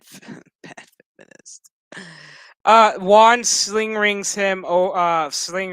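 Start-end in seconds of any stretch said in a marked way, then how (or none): 0.0-1.7 laughing. Real, not acted. Laughing, barely voiced.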